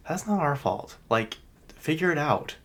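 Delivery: high-pitched, exasperated complaint; retold indignation. Retold protest at airline's excuse.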